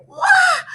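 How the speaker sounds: very high wail; wailing wah. A narrator's reading. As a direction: wail like a hurt kid. Kids hit by drumstick.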